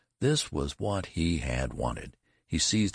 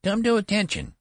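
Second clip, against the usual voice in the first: very high, loud treble command; attempted thunder; 6 dB louder than usual. A narrator's reading. Boy general ordering the sentry, failed thunder in high treble. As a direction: try to thunder in a boy's treble. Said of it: "He tried to thunder".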